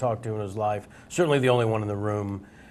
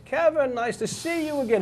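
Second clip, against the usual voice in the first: playing along; 13 semitones higher, 4 dB quieter than usual. Star greets Kevin at a charity function, playing along. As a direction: act out an old pal's surprised hello. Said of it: "He played it beautifully".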